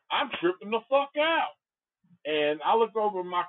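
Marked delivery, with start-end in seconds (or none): 0.1-1.5 higher-pitched retold freak-out; mock panicky exclamation. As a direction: act out tripping out, pitched well up. Acted panicked quote in story.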